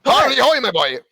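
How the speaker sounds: lightly voiced cartoon crab; a hint of Mister Krabs. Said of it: "Is that Mister Krabs?".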